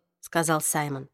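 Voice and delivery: ordinary delivery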